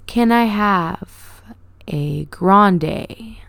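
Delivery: very slow, drawn-out order; condescendingly slow customer voice; talking slow. Retold or imitated. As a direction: spell it out slowly as if to a child. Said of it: "started talking me slow".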